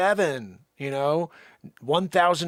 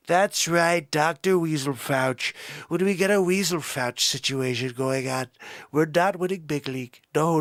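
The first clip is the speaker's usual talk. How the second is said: comic presidential impression, punchy and boastful; Trump. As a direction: put on a blustering politician voice. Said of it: "When you do a Trumper".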